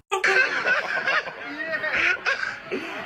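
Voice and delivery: high, wild shriek of joy; shrieking jackpot winner. Real, not acted. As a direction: scream with disbelieving delight. Friend's reaction to Newcastle win.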